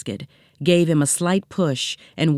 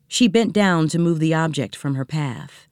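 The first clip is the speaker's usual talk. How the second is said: ordinary delivery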